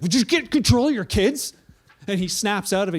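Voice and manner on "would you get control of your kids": sharp, louder, higher scolding of a stranger; annoyed lady. Retold or imitated. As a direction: snap at the father to fix it. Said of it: "this lady tells the man".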